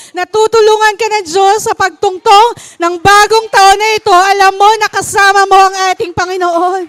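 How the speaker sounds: high, loud exhortation; high, loud full-force preaching; fervent preaching. Acted. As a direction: urge the listeners to have faith. Exhorting congregation.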